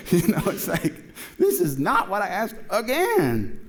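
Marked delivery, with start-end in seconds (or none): 1.4-3.6 high, drawn-out exasperated complaint; hammed-up, slow protest in a raised pitch; mock exasperation. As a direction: play up being fed up, word by word. Acted exasperated reply of the woman.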